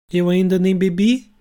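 offended. Acted. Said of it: "respondeu ofendida".

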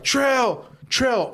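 retold calling out. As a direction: call his name to find him. Calling for a missing friend.

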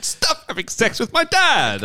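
high, slow mock whine; jokey jealous daughter, high and slow; mock outburst.

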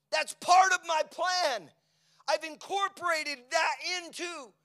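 loud, high preaching, slowing to a crawl; full-force preaching. Acted. Pastor preaching to the congregation at full force. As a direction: preach it out with power.

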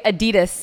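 Adidas the American way, stress on the middle DI; American pronunciation. Shown as a demonstration. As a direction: say Adidas as Americans do, uh-DEE-dus. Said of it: "In the U S, we say".

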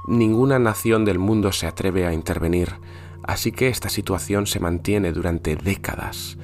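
hushed campfire horror narration; spooky storytelling. Acted. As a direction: tell it like a scary bedtime tale. Tells Santa as a horror tale.

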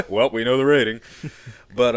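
laughing through the words. Real, not acted. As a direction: chuckle while saying it. Laughing after giving rating away.

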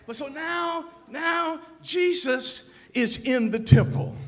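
high, loud proclamation, stretched out; impassioned preaching. Real, not acted. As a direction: proclaim it slowly at full force to the congregation. Preaching at full force.

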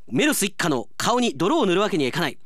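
ordinary talk

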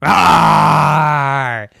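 loud growling monster roar; the stairs roar over the boy. Acted. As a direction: roar to scare him. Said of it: "and went".

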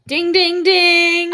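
game-show bell ding; idea ding. Pretend. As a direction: ring it out like a quiz bell. Game-show correct answer bell.

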